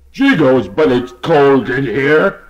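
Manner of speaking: a hint of shivering; cold horse. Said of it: "very frozen voice".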